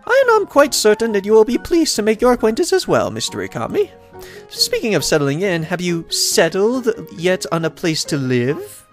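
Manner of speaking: high-pitched, welcoming lady mayor voice; pitched well up, pleased to see the newcomer; cheerful mayor. Acted. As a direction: voice a delighted mayor greeting a newcomer.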